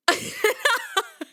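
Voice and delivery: high burst of laughter; laughing hard. Real, not acted. Reader reacting to the lisp article, bursting into high laughter. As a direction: crack up helplessly. Breaks up at her own story.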